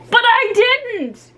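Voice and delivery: high, slow wailing protest; plead, half crying. Acted. Ricky protests to Jill, high wailing voice. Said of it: "Ricky wailed".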